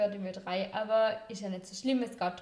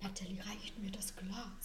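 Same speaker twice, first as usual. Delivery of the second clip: slow hushed whisper; 3 semitones lower, 12 dB quieter, slower than usual. Real, not acted. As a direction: whisper the request quietly. Whispered aside to co-host.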